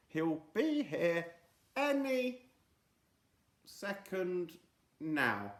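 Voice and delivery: slow, wavering, pitch jumping high. Acted. Friday to the waiting crowd, unsure and slow. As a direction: stretch it out, losing faith. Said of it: "a little less confidently this time".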